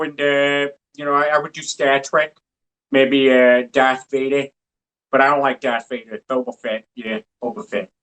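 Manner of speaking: lightly put-on New England accent as Peter; mild New England accent, Peter Griffin style. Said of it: "Can you do a New England accent".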